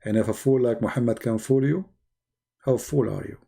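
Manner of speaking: ordinary delivery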